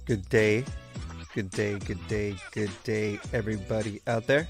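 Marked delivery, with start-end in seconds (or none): none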